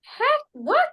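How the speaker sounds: shocked high yelp; surprised. Real, not acted. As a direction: jump up in disbelief. Shocked at pregnancy guess.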